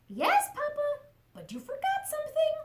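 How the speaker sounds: high, eager little chick voice; little chicken voice. Acted. Chick asking her papa for a bedtime story, high and eager. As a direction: squeak it up like a small excited chick.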